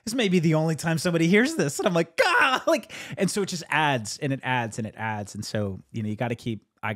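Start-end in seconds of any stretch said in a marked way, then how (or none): 2.2-2.6 startled yelp. Retold or imitated. Acting out own startled reaction.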